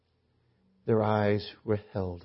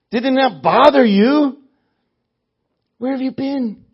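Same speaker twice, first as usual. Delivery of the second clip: sarcastic; 14 semitones higher, 16 dB louder than usual. Acted. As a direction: scold a clueless stranger in mounting sarcastic disbelief. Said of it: "fairly sarcastic tone".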